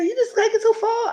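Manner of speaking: very high mock quote; imitating her. Quoting the castmate, a very high mock voice. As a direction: mimic her complaint, squeaky. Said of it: "She said".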